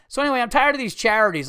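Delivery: loud, high, fast joke opener; abrupt stage segue. Retold or imitated. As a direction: barrel straight back into the act. Retells jumping straight back into act.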